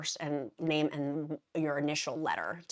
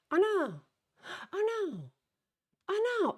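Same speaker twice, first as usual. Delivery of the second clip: rising high, squeaky oh nos; mock alarm at a discovery; dismayed oh no. Real, not acted. Vlogger finding her list out of order, high dismayed squeaks.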